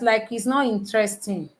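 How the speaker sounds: normal talking voice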